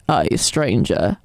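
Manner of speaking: low, slow mock Spanish-ish merchant line; lightly imitated old merchant greeting; mock merchant quote. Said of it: "He's just like".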